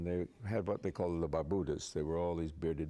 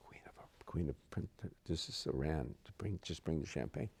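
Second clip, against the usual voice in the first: imitating an urgent whisper, rising to a low murmur; whispered start, halting and low; hushed aside; 5 semitones lower, 5 dB quieter than usual. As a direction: confide it quietly to a waiter.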